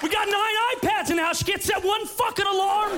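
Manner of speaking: high, fast bratty shout; teenage son shouting about his mom's alarm, bratty yell; kid yelling. Acted. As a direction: rant like a spoiled teen.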